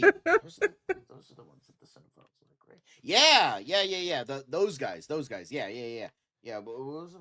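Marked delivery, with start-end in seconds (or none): 0.0-0.9 laughing mid-impression. Mock impression breaking into laughter. 1.1-2.8 hushed mock aside. Mock impression turning hushed.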